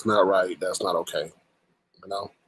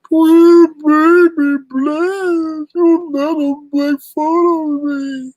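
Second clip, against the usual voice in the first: very high, slow, crying whine; mock big baby whine; 6 dB louder, much slower than usual. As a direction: ham up a whimpering crybaby. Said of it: "let's get to the big baby calling in".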